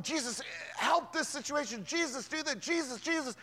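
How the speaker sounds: begging Jesus to fix it right now; frantic pleading. Retold or imitated. Preacher mimicking his own anxious prayers, urgently pleading. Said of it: "I'm going".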